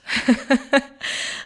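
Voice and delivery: a bright burst of real laughter, rising. Real, not acted. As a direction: laugh at how strange the world is. Laughing at coincidence.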